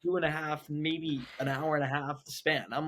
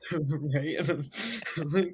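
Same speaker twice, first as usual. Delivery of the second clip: usual speaking voice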